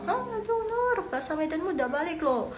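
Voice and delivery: high-pitched mock friend exclaiming; imitating friend. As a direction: mimic a friend teasing her about the Medan accent. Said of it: "terus temen Loshu bilang".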